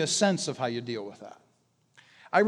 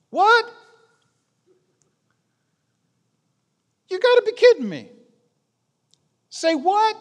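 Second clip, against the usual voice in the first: much higher and louder, a stunned outburst; hammed-up "you've got to be kidding" shock; mock astonished exclamation; imitating shocked disbelief; 14 semitones higher, 7 dB louder than usual. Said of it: "It's surprising".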